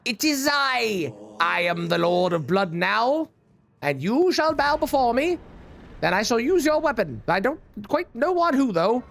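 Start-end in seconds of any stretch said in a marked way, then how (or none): 0.0-7.2 slow, lofty villain proclamation; hammed-up evil overlord; dark lord. Proclaims himself Lord of Blood.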